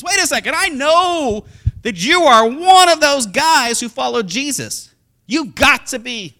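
nail him with it; accusing girl. Acted. Servant girl accusing Peter, high and loud.

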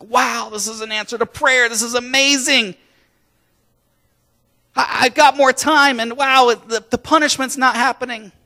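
high-pitched amazed relief; excited. Acted. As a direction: marvel aloud at an unexpected reprieve. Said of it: "that guy was excited".

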